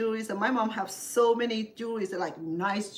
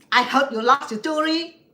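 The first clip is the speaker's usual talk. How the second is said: higher, louder, blunt big-brother voice; imitating older brother; 7 semitones higher, 11 dB louder than usual. Older brother calling his sister about her loss, blunt and loud. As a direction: bark it like a gruff older brother. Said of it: "that's the way he talked".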